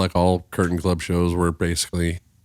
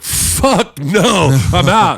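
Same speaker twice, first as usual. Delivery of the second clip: much higher, louder flat refusal; alarmed refusal; 9 semitones higher, 4 dB louder, much slower than usual. Retold or imitated. Buddy refusing to ride in the hotwired car, loud alarm. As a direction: back away fast from the deal.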